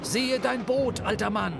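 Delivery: alarmed cry. A narrator's reading. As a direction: cry out to the old man. Starbuck warns Ahab. (hoher, flehender Ruf)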